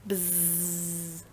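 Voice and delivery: low, soft sustained buzz; bee buzz. Shown as a demonstration. Teacher demonstrating animal sounds, drawn-out bee buzz. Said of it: "Animal sounds".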